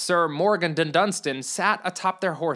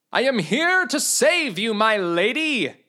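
gallant knight; 5 semitones higher, 3 dB louder than usual. Acted. Knight voiced in read story.

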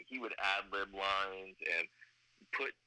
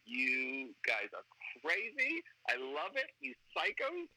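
delighted disbelief; 6 semitones higher, slower than usual. Retold or imitated. Writer to storyboard guys, delighted disbelief. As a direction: pitch climbing high to a squeal.

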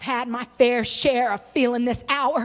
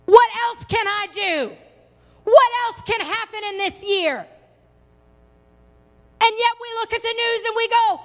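high, exasperated pulpit cry; impassioned preaching; 8 semitones higher than usual. Real, not acted. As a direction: pitch it high like a preacher at a loss. Preaches, voicing exasperated people.

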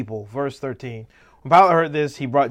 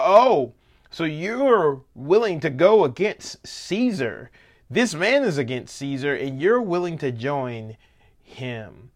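mock accusing; 2 semitones higher, 4 dB louder than usual. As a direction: draw it out as a pointed taunt. Acted taunt pressuring Pilate.

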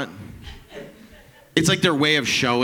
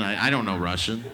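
ordinary talk

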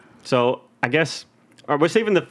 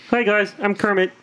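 higher frog-puppet voice; Kermit; 5 semitones higher than usual. Retold or imitated. Host retelling doctored movie clip, Kermit voice. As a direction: imitate a cheery puppet frog saying hello. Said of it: "somebody superimposed Kermit".

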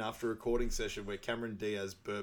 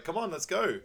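mock brisk Fiona; 9 semitones higher, 4 dB louder than usual. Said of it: "she's like".

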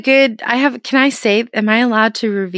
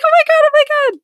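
high-pitched giddy excitement; excited squeal. Real, not acted. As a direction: squeal with delight. Excited about celebrity like.

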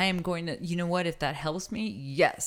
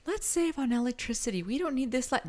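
slightly mocking, higher-pitched partner voice; voicing partner; 8 semitones higher than usual. Partner objects to lights being left on, mock nagging. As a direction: lightly mimic a penny-pinching partner.

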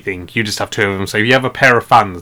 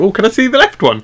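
high mock question; 10 semitones higher than usual. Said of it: "You come out with one shoe".

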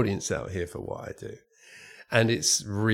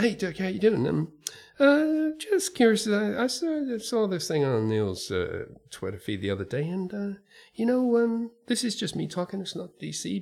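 much higher, casual and offhand, like a friendly exec sounding you out; breezy executive voice; 8 semitones higher than usual. Retold or imitated. Sandy from DC emailing Dirk, casual high breezy pitch. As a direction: play Sandy tossing out a big idea as if it's nothing.

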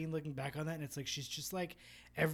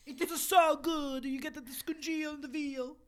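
put-on Italian accent; 9 semitones higher, 6 dB louder than usual. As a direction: savour it like a pizza chef, Italian accent. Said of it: "in a fucking cartoon pizza chef".

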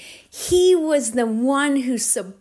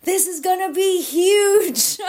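imitating Dom, pitched higher and bolder; bigger excited quote; 7 semitones higher, 4 dB louder than usual. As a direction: belt out his hype, higher than your own voice. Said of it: "And he'd be like".

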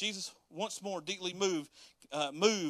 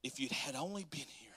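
whisper; 2 semitones lower, 8 dB quieter than usual. Acted. Martha's grief, whispered quote.

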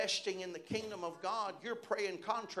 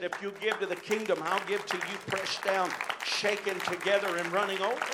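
louder promise cadence, building; lightly lifted into preaching voice; rising to preaching; 8 dB louder than usual. Acted. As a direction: declare the scripture to the congregation.

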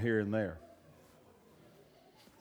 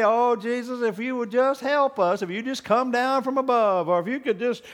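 lightly voiced whiny begging prayer, higher; mock pleading prayer; 8 semitones higher, 3 dB louder than usual. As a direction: imitate a weak, begging believer. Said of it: "doesn't talk like that".

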